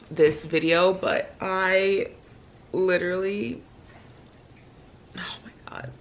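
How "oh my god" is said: very soft, voiceless gasp of words; overcome whisper. Real, not acted. Reader lost for words about the book, whispering. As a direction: let it escape as a whisper.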